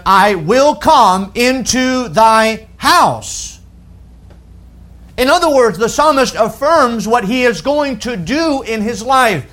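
high, deliberate pulpit declaration; emphatic preaching. Real, not acted. Preacher affirming the psalmist's habit to listeners, emphatic preaching.

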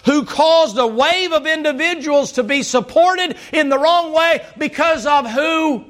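high-pitched, driving Southern sermon delivery; impassioned preaching. Real, not acted. Preaches at full force.

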